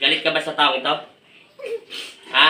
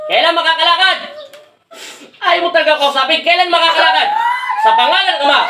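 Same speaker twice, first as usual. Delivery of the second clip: high, raised-voice exorcist's demand; real heated command; forceful demand; 8 semitones higher than usual. Real, not acted. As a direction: confront the spirit at a near shout. Commands the spirit, getting no answer.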